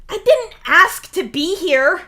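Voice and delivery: high, loud indignant yell; yelling back. Acted. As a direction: yell it back in protest, drawn out. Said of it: "he yells back at you".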